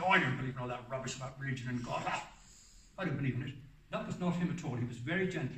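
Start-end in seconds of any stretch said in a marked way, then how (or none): 0.0-3.5 brisk, dismissive scoffing at religion; quick mock disdain, half-hearted; aggressive atheist. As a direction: rattle it off like a scornful know-it-all. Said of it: "He didn't say it in an aggressive way".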